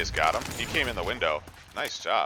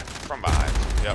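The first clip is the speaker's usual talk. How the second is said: ordinary talk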